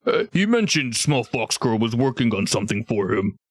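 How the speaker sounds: lightly voiced ogre, sizing up a visitor; a hint of an ogre asking questions; light ogre character voice; ogre camp trader. Game master plays an ogre.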